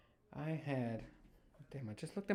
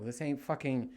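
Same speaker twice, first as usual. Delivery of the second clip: ordinary talk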